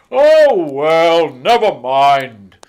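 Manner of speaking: loud, slow forgiving Santa; jolly Santa. A narrator's reading. Santa forgiving the reindeer, loud and jolly. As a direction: wave it off kindly.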